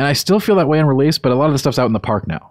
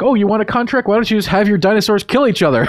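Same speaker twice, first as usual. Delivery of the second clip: higher, mock-casual sales pitch; mock contract offer; 9 semitones higher than usual. Game character offering a dinosaur-fight contract, higher mocking voice. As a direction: pitch it up, imitating an absurd offer.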